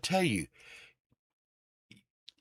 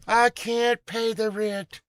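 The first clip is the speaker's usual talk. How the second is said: high, slow pleading damsel; damsel in distress; 10 semitones higher, slower than usual. Acted. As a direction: plead like a helpless maiden. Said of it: "I just did a play for y'all".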